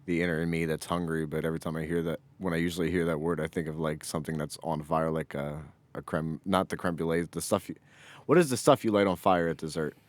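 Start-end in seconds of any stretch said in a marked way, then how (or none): none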